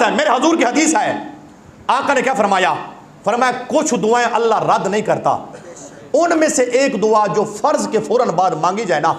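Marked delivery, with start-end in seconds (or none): none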